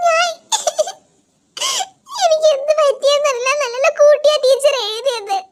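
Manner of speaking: hammed-up tearful student pleading; mock crying kid. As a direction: sob out the excuse like a caught kid. Kid cries explaining mistake.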